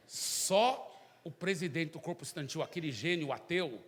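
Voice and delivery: usual speaking voice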